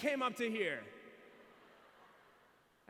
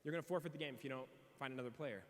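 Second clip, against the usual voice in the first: soft, lower, regretful ruling; apologetic umpire; 5 semitones lower, 8 dB quieter than usual. Retold or imitated. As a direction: break bad news gently. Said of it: "the umpire goes".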